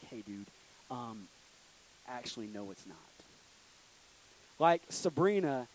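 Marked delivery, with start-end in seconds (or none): 0.0-3.0 sheepish letdown. Retold or imitated. Friend texts to cancel the plan.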